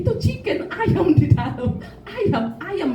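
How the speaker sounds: a hint of a child's chirp; imitated child. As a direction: quote the child with a playful lift. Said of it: "anaknya bilang begini".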